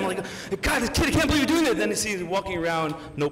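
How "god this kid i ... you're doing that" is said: loud, high frustrated outburst; exasperated dad. Retold or imitated. Dad fed up with his toddler's mess, loud exasperated outburst. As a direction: vent at the toddler in disbelief. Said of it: "I wanna yell at him".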